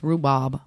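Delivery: rhubarb pronounced "roo-bob", R dropped. Shown as a demonstration. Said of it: "No R in the word".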